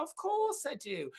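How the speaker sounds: high, swooping mock delight; retold eager reply. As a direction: act out gushing recognition on the phone. Own reply in retold phone call.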